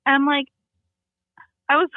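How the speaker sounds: ordinary delivery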